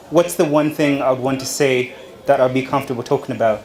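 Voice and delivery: ordinary talk, nothing marked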